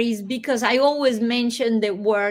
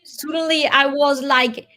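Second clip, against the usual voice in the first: sudden urgency; 4 semitones higher, 6 dB louder than usual. Retold or imitated. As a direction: voice jumps up high and loud. Recalling sudden desperation, louder, higher.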